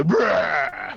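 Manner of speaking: a hint of a roaring mummy; monster roar. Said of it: "like in The Mummy".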